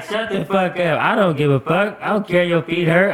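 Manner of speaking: high, loud retold scolding; imitated heated tirade; furious granny. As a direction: tear into him as granny. Granny yelling at him.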